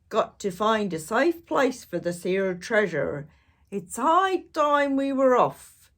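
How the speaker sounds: hearty pirate captain; pirate announcement. Acted. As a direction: announce it to the whole crew. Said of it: "he announced".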